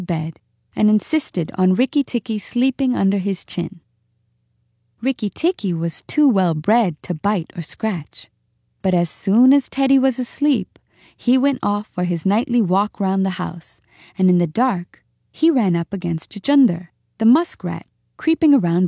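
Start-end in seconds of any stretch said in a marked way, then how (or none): none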